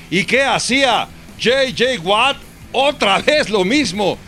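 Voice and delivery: excited. Real, not acted. Excited highlight commentary.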